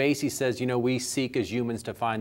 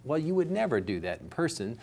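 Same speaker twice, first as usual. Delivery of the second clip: ordinary talk, nothing marked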